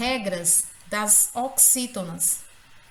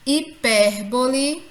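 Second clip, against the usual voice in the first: Hipérbole slow and loud, PÉ punched; stress on PÉ; 3 semitones higher, 6 dB louder, slower than usual. Shown as a demonstration. Teacher giving examples to the class, Hipérbole stressed on PÉR. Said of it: "é a sílaba mais forte".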